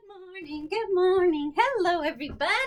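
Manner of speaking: bright, loud sing-song hello; high and loud good morning; cheery greeting. Real, not acted. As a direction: greet the class with big cheer. Teacher greeting class on video.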